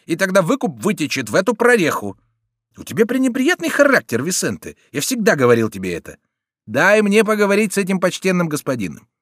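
high, loud heated argument; quarrelling bandit. A narrator's reading. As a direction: hold your comrade back, forcefully. Bandits quarrel over killing the captive.

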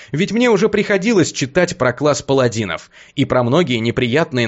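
ordinary delivery

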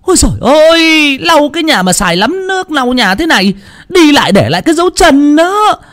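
very high shrill furious complaint; roaring mother-in-law. A narrator's reading. Mother-in-law roars about the floor, outraged. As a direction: scream about the wet floor. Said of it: "tiếng của bà Tuyết lại gầm lên".

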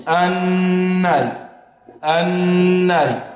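slow "النار" as a long-held "annn-nāri"; assimilated lam. Shown as a demonstration. Teacher showing the sun letter, long n in "النار". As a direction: merge the ل of "النار" into a long hummed n. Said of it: "تدغم، نعم".

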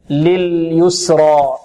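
slow recited لليسرى with thick ر; light seen, heavy raa. Shown as a demonstration. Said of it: "السين هنا كذلك مرقة والراء مفخمة". (لليسرى بسين مرققة وراء مفخمة)

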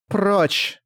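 high otherworldly command; inhuman voice. Acted. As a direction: bark it like something not human. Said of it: "раздался совершенно нечеловеческий голос".

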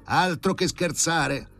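shouted. A narrator's reading. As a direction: wail it in mock despair. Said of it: "gridò Filippo Filippovic". (grido teatrale, molto più acuto e lento)

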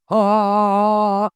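loud, long held "aah"; bellowed open-throated wail; chesty yell. A narrator's reading. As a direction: trance cry, held and loud. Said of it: "орёт низким грудным голосом".

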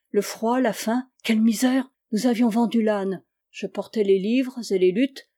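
plain reading voice

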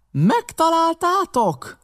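very high, drawn-out yell; impatient stretched-out bellow; howled question. A narrator's reading. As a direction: howl the question back up. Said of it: "üvöltött vissza Pew".

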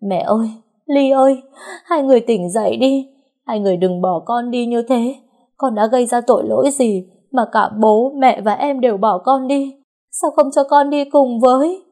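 high, loud sobbing wail over her mother and sister; loud grieving wail, pitched high; wailing and crying. Acted. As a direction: cry out in raw grief, voice breaking. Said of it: "ôm lấy em mà gào khóc".

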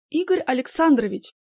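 обиженный упрёк, громко; с обидой и поджатыми губами; обиженно. A narrator's reading. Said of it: "обиженно поджала губы". (обиженно)

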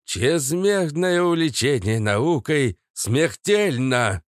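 slow, menacing proclamation; theatrical mad-scientist warning, unhurried; ominous. A narrator's reading. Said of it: "зловеще произнёс".

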